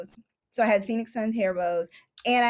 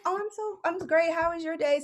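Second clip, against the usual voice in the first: flustered delight; 8 semitones higher than usual. Retold or imitated. Idol asks about her day.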